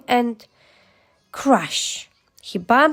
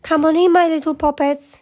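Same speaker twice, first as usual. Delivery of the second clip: high, delighted calling out; bright-pitched, eager welcome; excited cry; 6 semitones higher than usual. A narrator's reading. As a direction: call the little ones in, pitch lifted. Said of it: "she cried".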